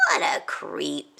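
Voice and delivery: disgusted. Acted. Stuart disgusted with Todd's teasing. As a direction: sneer at him.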